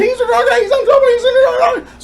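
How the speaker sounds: high, loud, gabbled mimicry; mocking accusers. Son mimicking family calling him a drug addict, mocking squeal. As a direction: parody a hysterical accusation.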